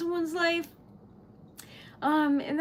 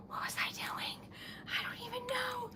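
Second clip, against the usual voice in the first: soft whisper to herself; flustered whisper; 8 semitones higher, 12 dB quieter than usual. Real, not acted. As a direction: lose the thread and whisper it under your breath. Flustered, asking herself under breath.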